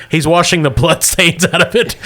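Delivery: ordinary talk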